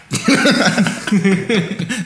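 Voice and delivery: high bursting laugh; burst of laughter. Real, not acted. Laughs at own coffee joke.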